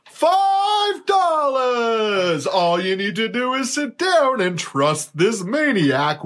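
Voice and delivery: very high, stretched-out huckster cry; hammed-up ad shout. Host selling cheap haircuts in a mock ad, shouted pitch. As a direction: holler the price, then keep pitching slowly. Performing a mock barber shop ad.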